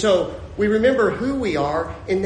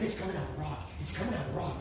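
much softer, awed aside; hushed marvelling; 3 semitones lower, 13 dB quieter, much faster than usual. Acted. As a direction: drop to an amazed near-whisper.

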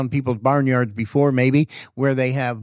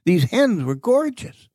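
slow and higher, marvelling; amazed; 6 semitones higher, slower than usual. Real, not acted. Storyteller marvelling at the healed hens, slow and higher. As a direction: savour how huge they were.

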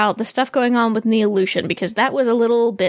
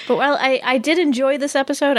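ordinary talk

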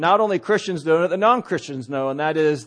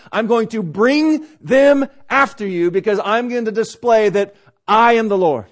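raised, higher preaching voice as God; forceful divine command; 5 semitones higher, 5 dB louder than usual. Acted. God telling Israel to camp by the sea, forceful proclamation. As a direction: proclaim it with mounting force and heavy emphasis.

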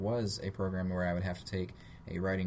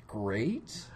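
"Great?" with a rising question tune; 3 semitones higher than usual. Retold or imitated. New guy made spokesperson, answers "Great?" with a doubtful rise. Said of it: "Question mark".

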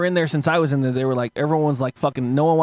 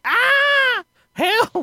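high, hammed-up yelp for help; mock cry for help; 4 dB louder than usual. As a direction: wail it like a cartoon victim.

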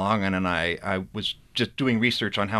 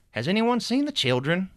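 much higher anxious sister; higher worried voice; 10 semitones higher than usual. Acted. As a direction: Sister Honora looking for the children, anxious. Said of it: "doing a multitude of voices".

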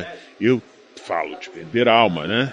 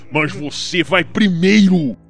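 louder, slow final threat; 6 semitones higher, 10 dB louder, slower than usual. Acted. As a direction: deliver the last line with relish. Final threat before shooting. (ameaça final lenta e alta)

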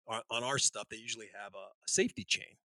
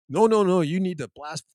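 louder, higher no no no; mock alarmed protest; 6 semitones higher, 9 dB louder than usual. Safety guy protests the Russians' blast rules. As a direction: cut in, alarmed.

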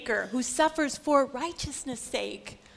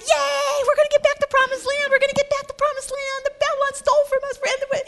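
giddy crowd; 12 semitones higher, 7 dB louder, faster than usual. Acted. Crowd excited about promised land.

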